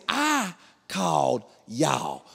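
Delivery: drawn-out, word-by-word protest; slow disbelieving protest. Retold or imitated. Husband reminding police who called, slow disbelief. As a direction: space out every word in disbelief.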